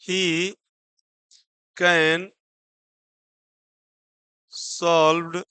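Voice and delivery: slow, word-by-word dictation; slow classroom dictation; dictating slowly. Shown as a demonstration. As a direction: leave long gaps between words for writing. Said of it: "अब इसको क्या पढ़ेंगे".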